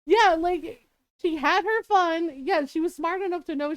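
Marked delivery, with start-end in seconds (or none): none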